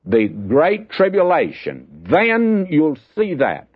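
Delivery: slow, rising to a high cry on see; preacher's slow, high emphasis; emphatic warning. Acted. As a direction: press the warning home, slowly. Preacher stressing the coming terror.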